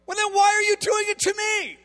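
high, loud rebuke; indignant shout. Retold or imitated. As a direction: shout the question at them in exasperation. God rebukes the leaders.

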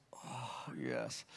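very soft, low, drawn-in murmur of consent; soft murmured yes. Retold or imitated. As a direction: breathe it out barely audible, eyes closed. Woman quietly accepting prayer.

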